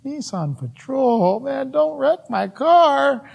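slow mock lament, rising high; dismayed. Driver who has just hit a car, mock-dismayed. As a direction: draw it out as a comic protest.

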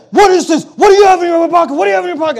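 high, loud cop demanding answers; a cop who thinks he found a gun; mock alarmed cop.

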